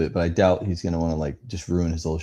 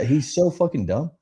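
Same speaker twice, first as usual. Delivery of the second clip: ordinary delivery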